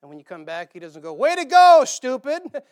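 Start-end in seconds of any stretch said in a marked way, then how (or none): 1.1-2.6 sarcastic put-down. Retold or imitated. Mock sarcastic scolding God.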